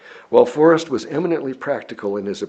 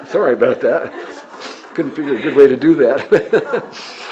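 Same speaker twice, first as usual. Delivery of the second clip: louder, laughing through the words; laughing apology; 2 semitones higher, 6 dB louder than usual. Real, not acted. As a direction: apologize while still laughing. Apologizes after interruption, laughing.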